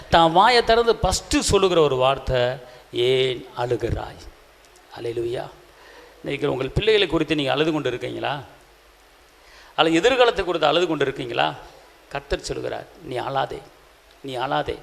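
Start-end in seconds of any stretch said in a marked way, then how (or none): 2.9-4.2 low, slow, hushed question; gentle asking. Acted. The risen Jesus' first words, asked gently. 13.0-14.8 low, soft reassurance; gentle comforting. Acted. The Lord tells the weeping believer, gently comforting.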